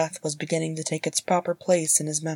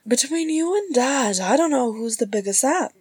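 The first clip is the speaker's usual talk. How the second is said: high-pitched, exasperated woman's voice; high, lilting character voice; teasing sister; 8 semitones higher than usual. A narrator's reading. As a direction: tease a brother fondly.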